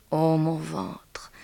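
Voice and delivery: slow, half-whispered lament; hushed apostrophe. Acted. Macaré addressing her own belly, slow and hushed. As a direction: breathe it out slowly, almost whispered.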